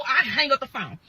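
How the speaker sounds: normal talking voice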